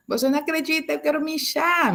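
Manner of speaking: put-on high, pleading child's voice, tearful; a child whining she needs to pee; crying little girl. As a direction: imitate a small girl begging through tears. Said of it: "Y empezó a llorar".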